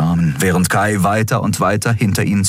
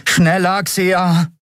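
high, urgent cry; anxious raised call; urging; 11 semitones higher, much slower than usual. A narrator's reading. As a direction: push someone to hurry. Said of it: "drängte Miko".

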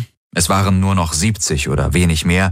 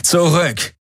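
high, sharp order; commanding; 10 semitones higher than usual. A narrator's reading. Guard leader Tari to Galen stepping forward, commanding. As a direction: order him to back off. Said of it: "befahl Tari".

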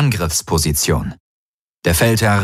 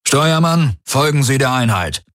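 pitched-up order to the crew; command; 6 semitones higher than usual. Acted. Commander orders helmsman to follow, command. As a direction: give the helmsman a firm order.

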